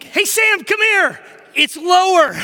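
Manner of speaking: high, shouted dad summons; loud, exasperated parental alarm; urgent calling out. Retold or imitated. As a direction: holler for your kid with comic urgency. Dad calling son over again.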